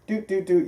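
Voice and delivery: imitated autopilot beeps. Mimicking the autopilot engaging.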